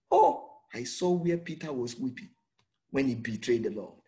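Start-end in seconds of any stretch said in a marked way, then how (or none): 0.1-0.6 high cry of oh. Real, not acted. Preacher cries out.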